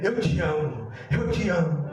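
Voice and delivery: low, hushed reassurance; tender retold voice. Comforting voice to a man fallen back, low and tender. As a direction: drop down low and say it gently. Tender voice replaces the evil one.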